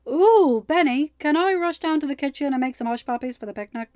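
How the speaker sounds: high, loud eager asking; bright eager request; excited plea. A narrator's reading. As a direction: beg like an excited kid. Excited girl asks a favor.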